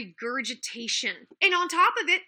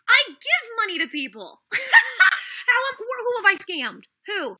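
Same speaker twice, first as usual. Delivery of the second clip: laughing in disbelief, voice jumping high; bitter laughing disbelief; incredulous laugh; 7 semitones higher, 4 dB louder, slower than usual. Real, not acted. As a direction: laugh it off, then demand who.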